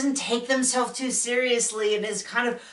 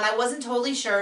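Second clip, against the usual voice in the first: ordinary delivery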